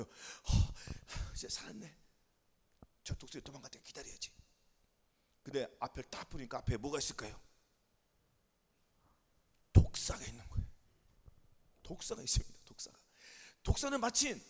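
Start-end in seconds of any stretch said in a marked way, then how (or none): 0.0-4.3 hushed relief. Acted. Pigeon hiding from the eagle in a rock hole, hushed relief. 9.7-13.0 forceful stage whisper, trailing away; whispered suspense reveal. A narrator's reading. Preacher revealing the viper to the congregation, whispered suspense.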